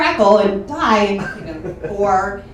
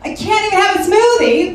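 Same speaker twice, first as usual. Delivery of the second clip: high, put-upon comic complaint; mock exasperation; 7 semitones higher than usual. Comic reacting to mom's bad-news voicemail, mock exasperation. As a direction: whine as if the day is spoiled. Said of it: "My first thought was".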